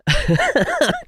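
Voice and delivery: short high giggle; high amused laugh. Real, not acted. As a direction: laugh at the chat. Laughs at chat comment.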